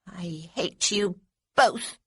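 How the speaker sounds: slow, deliberate hateful words; slow seething. A narrator's reading. Little girl seething at both boys, slow and bitter. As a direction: let each word drip with spite.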